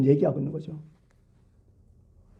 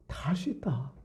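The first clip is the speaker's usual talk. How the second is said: whisper; 13 dB quieter than usual. Acted. God's promise whispered to listeners.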